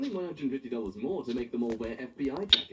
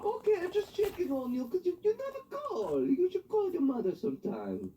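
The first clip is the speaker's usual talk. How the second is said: high nagging mom voice, much higher; guilt-trip like a fussy mother; shrill-free high mock mom nag. Voicing nagging mother from text.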